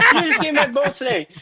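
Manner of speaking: high, laughing mock protest; laughing mock outrage. Husband laughs retelling his outrage at wife giving his snake away. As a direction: squeal it out through laughter.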